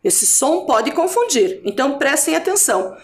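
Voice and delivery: usual speaking voice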